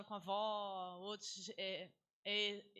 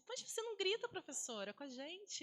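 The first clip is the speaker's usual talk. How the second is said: put-on high, wondering child question; surprised pupil; 7 semitones higher, 3 dB quieter than usual. Teacher imitating a surprised pupil asking, higher voice. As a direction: ask it like an amazed kid. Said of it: "Aí alguém disse".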